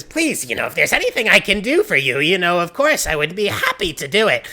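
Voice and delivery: high, loud, gushing with anxious eagerness; panicked villager. Acted. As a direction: babble desperate promises to placate someone.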